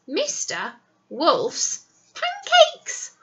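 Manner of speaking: plain reading voice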